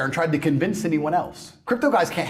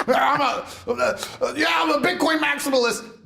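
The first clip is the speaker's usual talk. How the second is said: mock crypto bro; 8 semitones higher than usual. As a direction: puff up like a proud Bitcoin bro. Mocking crypto bro self-description.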